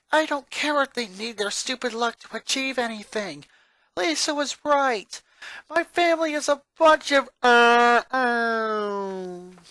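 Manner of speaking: building from fed-up to a high, loud outburst that breaks off; boy venting at his family until he sputters; frustrated rant. Acted. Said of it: "He was cut off".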